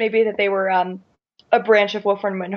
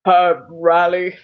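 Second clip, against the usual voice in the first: slow, drawn-out goofy demon; silly demon voice; 3 semitones lower, 4 dB louder, much slower than usual. Retold or imitated. Mocking the demon's voice choice to fellow hosts, silly demon voice. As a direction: drag the name out like a silly monster. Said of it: "do that with his voice".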